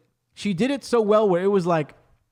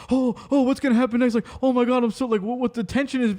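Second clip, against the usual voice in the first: raised, mock-tense gasping; mock suspense; 5 semitones higher, faster than usual. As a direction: fake breathless suspense, pitched up. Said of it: "they think you're gonna go".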